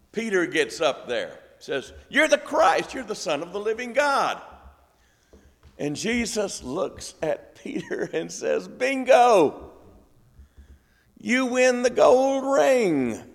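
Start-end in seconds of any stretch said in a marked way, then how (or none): 2.1-4.4 much higher, excited burst of the answer; high, fast, excited Peter; eager blurt. Retold or imitated. 8.7-13.2 louder, much higher, slow game-show host cry; booming quiz-host Bingo; gameshow cheer. Retold or imitated. Jesus cheers Peter's right answer.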